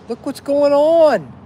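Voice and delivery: loud, high outburst; loud rant at its peak; shouting. Real, not acted. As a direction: shout it at the world. Ranting about the world.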